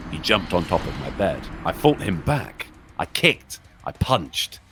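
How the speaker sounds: low, hushed horror narration; creepy story narration. Acted. Streamer reading a creepypasta to chat, hushed and ominous. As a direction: spin a scary tale for the viewers.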